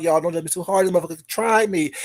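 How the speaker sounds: usual speaking voice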